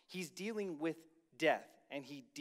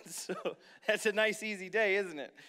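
loud, laughing aside to the crowd; amused, louder, words breaking into a laugh; laughing while talking; 4 semitones higher, 7 dB louder than usual. Real, not acted. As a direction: talk through real laughter. Preacher laughing at own joke.

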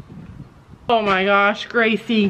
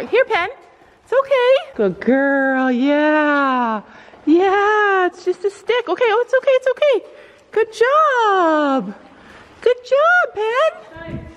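high soothing pony voice; 8 semitones higher, slower than usual. Real, not acted. Owner calming pony near the stick, high coaxing praise. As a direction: reassure a frightened animal up high.

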